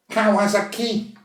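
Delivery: slow, high Kawasaki, punching KI; stress on last syllable. Shown as a demonstration. Contestant teaching the taxi call, Kawasaki stressed on its final syllable. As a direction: draw out Kawasaki and land hard on KI. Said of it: "en accentuant la dernière syllabe".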